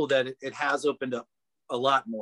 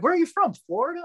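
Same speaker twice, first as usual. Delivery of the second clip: high-pitched mock puzzled customer; puzzled questioning; 4 dB louder, faster than usual. Store customers quizzing the cashier's origins, high puzzled imitation.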